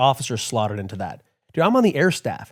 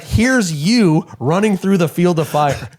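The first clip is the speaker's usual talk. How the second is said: amused retelling; 8 semitones higher, 8 dB louder, slower than usual. Retold or imitated. Boss mocking him with drawing.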